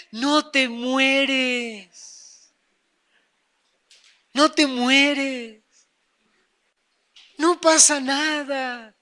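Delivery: slow, drawn-out tempter; devil's voice. Acted. Devil assuring that nothing happens, slow and coaxing. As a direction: stretch each word out soothingly. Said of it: "Eso es la voz del diablo".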